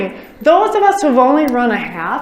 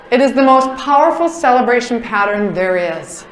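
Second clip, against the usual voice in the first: ordinary talk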